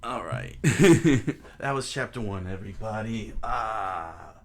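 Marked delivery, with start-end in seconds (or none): 0.6-1.5 real burst of giggling; loud laugh. Real, not acted. Reader wrapping up the chapter, loud burst of laughter.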